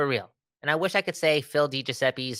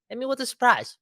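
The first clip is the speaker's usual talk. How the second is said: much higher, bouncy "Hit me with a surprise"; playful high repeat; 12 semitones higher than usual. Shown as a demonstration. Host teasing co-host for a surprise pick, playful high. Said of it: "repeats request, second time higher".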